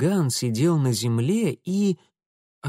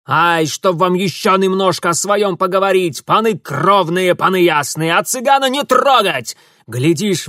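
higher, louder wheedling Roma voice; Roma man in pain; 6 semitones higher, 8 dB louder than usual. A narrator's reading. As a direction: plead and grumble through the bite. Roma captive pleads, voiced.

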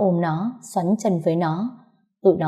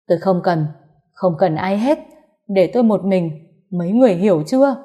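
firm, heated refusal, louder at the end; angry dismissal; 4 dB louder than usual. A narrator's reading. Mother-in-law sending the family away, angry. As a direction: cut them off and demand to be left alone.

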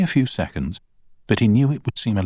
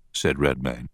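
plain reading voice

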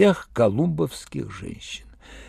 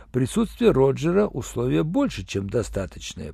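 usual speaking voice

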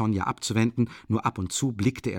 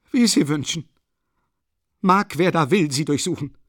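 higher, louder furious cry; shouting; 6 semitones higher, 5 dB louder than usual. A narrator's reading. Katerina shouts at Luschin to search Sonja. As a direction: fling the words out in a rage. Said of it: "schrie Katharina Iwanowna".

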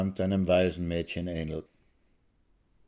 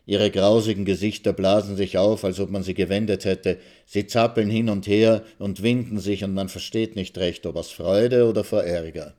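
plain reading voice

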